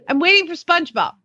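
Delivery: put-on high child's answer; imitated little boy. Mom mimicking her son waiting in the front yard, lightly voiced child. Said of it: "He's like".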